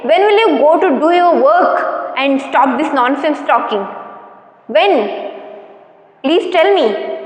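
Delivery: ordinary talk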